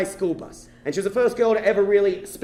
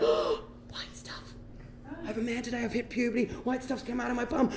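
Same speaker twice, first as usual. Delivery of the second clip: very soft, breath-light wonder; awed hush; 2 semitones higher, 11 dB quieter than usual. Retold or imitated. Boy alone marvels at reaching puberty, awed hush. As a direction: marvel to yourself, barely aloud.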